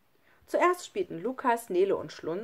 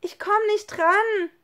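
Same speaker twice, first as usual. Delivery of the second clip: very high, slow, loud wail; desperate call; 14 semitones higher, 8 dB louder, slower than usual. A narrator's reading. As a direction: cry out while failing to reach. Child straining to reach the hand. (lauter, gedehnter, verzweifelter Ruf)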